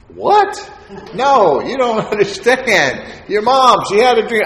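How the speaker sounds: baffled he didn't get it; incredulous stepdad. Retold or imitated. Stepdad insisting, surprised.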